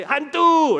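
loud, high mock scream of alarm; hammed-up frightened shout; terrified cry. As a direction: yelp it as if seeing a ghost. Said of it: "they were terrified".

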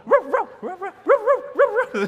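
high barks traded with an echo; rapid bursts of high ruffs; imitated dog bark. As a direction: bark over and over at your own echo. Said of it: "he barks at his own echo".

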